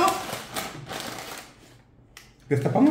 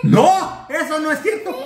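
excited; 8 semitones higher than usual. Real, not acted. Denies T-Rex man.

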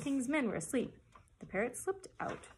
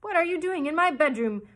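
loud, higher outraged demand; king's cry; 7 semitones higher, 11 dB louder than usual. A narrator's reading. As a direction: cry out at an intruder. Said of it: "cried the king".

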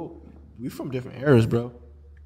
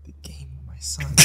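whispered dilemma; 19 dB quieter than usual. Retold or imitated. Father agonizing over bet or son, whispered. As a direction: repeat it in a hushed whisper.

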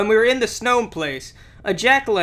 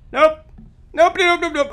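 high, louder rapid nopes; panicked nopes; 10 semitones higher, 6 dB louder than usual. Real, not acted. Gamer fleeing a monster, high panicked nopes. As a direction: back away in alarm.

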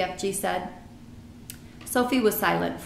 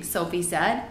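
usual speaking voice

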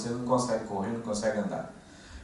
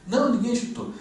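high-pitched retold protest; high mock retort; acted denial; 12 semitones higher than usual. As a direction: imitate a teammate denying it, voice pitched high. Acted reply to injured player.

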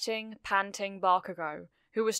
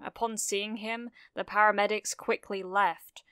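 ordinary delivery

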